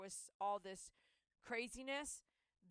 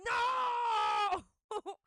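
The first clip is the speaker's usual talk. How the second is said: high, loud desperate screaming of no; frantic, crying yell, much higher and louder; panicked shout; 12 semitones higher, 11 dB louder than usual. Retold or imitated. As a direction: shriek at the dog not to die. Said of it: "I was like".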